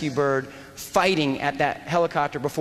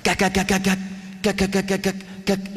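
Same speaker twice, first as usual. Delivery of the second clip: clipped gak bursts like gunfire; machine gun bursts. Shown as a demonstration. Instructor imitating a machine gun burst, clipped rhythmic bursts. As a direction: spit out short gun bursts. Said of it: "so it sounds sort of like this".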